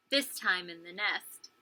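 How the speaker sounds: plain reading voice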